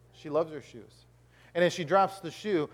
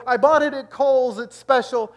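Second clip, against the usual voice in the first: high, loud, protesting wife voice; mock indignant wife; 7 semitones higher, 9 dB louder than usual. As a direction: defend the shoe in a hammed-up high voice. Voices wife defending her shoe.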